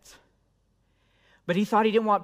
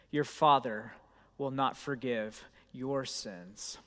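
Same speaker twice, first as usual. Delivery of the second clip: scripture reading; 3 semitones lower, 5 dB quieter than usual. Acted. Pastor reading scripture to congregation, solemn and measured. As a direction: read it reverently, slowing at the end. Reads scripture passage aloud.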